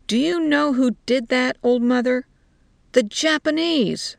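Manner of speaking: emphatic, accusing soldier; heated challenge. A narrator's reading. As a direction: press the old woman hard, ending on a high fall. Soldier angrily challenges old woman.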